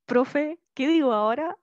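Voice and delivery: loud demo of one's own squeaky voice. Shown as a demonstration. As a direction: ask it in your everyday squeaky voice. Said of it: "con mi voz chillona de siempre".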